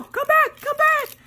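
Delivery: high-pitched desperate call after someone. Retold or imitated. Runner chasing after the delivery lady, desperate calling. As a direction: call them back, high and urgent.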